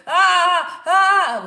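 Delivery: loud, high re-enacted labor screams; two sharp cries of sudden pain; retold scream. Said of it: "I heard my own screaming".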